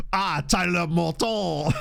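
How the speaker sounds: slow mock French accent. Streamer imagining the player turned French, slow mock French accent. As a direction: draw out Tyler Morton like a Frenchman. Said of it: "With a French accent".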